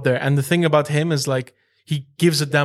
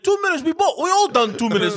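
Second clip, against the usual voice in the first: high mock Belarusian accent, broken English; lightly hammed Sergio voice; imitating Sergio; 10 semitones higher than usual.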